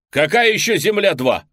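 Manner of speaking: loud, high angry yell; angry shout. Acted. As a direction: yell it in disbelief. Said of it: "кричу я".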